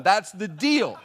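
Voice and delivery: loud, emphatic mock outrage; indignant rant. Customer stating the pizza contract, mock indignation. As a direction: lay down the law, comically heated. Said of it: "angry old man rant".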